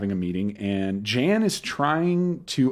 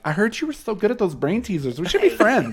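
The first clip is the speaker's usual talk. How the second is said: put-on high eager girl voice; imitating Andy; 8 semitones higher than usual. Classmate overhearing at lunch, high eager voice. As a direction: play up the eager kid asking to be friends. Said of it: "she's like".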